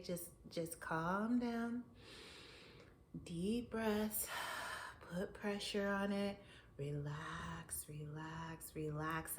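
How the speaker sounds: soft, slow self-soothing; calming inner voice. Retold or imitated. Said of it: "I heard my sage, myself say".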